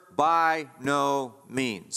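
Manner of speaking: "By no means" very slow, each word weighted; strongly emphatic. Acted. Preacher answers whether the law is sin, strongly emphatic. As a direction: stretch out "By no means" as a decisive answer. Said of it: "This is a strong emphasis".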